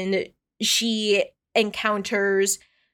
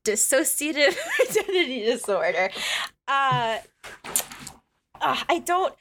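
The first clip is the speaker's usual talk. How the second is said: higher, laughing through the term; 4 semitones higher than usual. Real, not acted. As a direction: laugh midway through the words. Laughs when asked to explain it.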